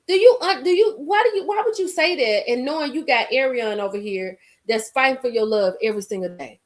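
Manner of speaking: usual speaking voice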